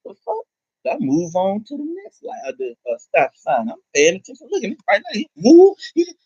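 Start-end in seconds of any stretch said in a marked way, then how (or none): 0.0-0.4 mock scared. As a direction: imitate the cops faking fear, much higher. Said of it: "they're like".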